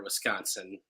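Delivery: normal talking voice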